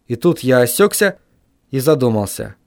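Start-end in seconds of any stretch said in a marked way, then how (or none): none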